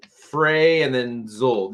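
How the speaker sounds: fray and then zol spelled out slowly as two syllables; teacher sounds out the word syllable by syllable; spelled-out pronunciation. Shown as a demonstration. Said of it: "the way I would kinda say it".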